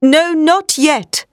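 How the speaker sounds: slow, high firm command; demanding. A narrator's reading. Professor stopping Arthur from kissing Lucy, slow firm demand. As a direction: stop him with each word. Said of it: "he demanded".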